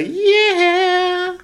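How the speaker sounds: very high, slow squeak; high squeaky voice. Acted. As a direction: stretch the yeah way up high. Rating said in squeaky drawn voice.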